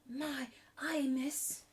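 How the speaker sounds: loud, high gasp of wonder; amazed exclamation. A narrator's reading. Becky gasping in wonder at the feast. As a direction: burst out in awe. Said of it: "ejaculated Becky".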